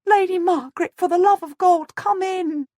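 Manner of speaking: high, loud desperate begging; whimpering plea. A narrator's reading. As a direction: plead for God's sake, almost crying. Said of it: "the woman whimpers".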